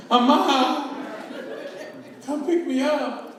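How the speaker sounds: put-on pleading kid's call. Beaten-up kid calling mom from the payphone, pleading. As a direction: call out for mama to come get you. Child phoning mom for rescue.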